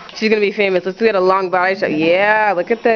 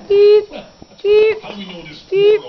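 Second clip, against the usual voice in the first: high beep noise; 11 semitones higher, much slower than usual. Pretend. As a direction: beep each poke high. Poking sister making beep noises.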